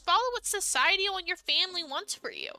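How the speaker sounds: bitter sarcasm at the heroine's choice; sarcastic anger. Real, not acted. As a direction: sneer it back at her. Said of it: "I'm getting so mad".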